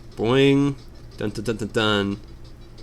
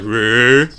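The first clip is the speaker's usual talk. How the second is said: low growling rumble; deep man growl; 2 semitones lower, 6 dB louder than usual. Retold or imitated. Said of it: "sounded like a really deep man's voice".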